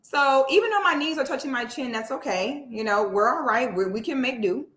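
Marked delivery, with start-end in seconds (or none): none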